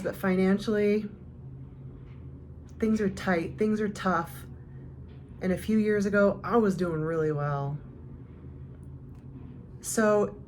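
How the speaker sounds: ordinary talk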